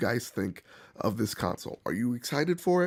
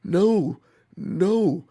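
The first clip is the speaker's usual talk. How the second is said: loud, high double no; emphatic no; 5 semitones higher, 6 dB louder than usual. Real, not acted. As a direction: shut the idea down hard. Scolding eager buyers.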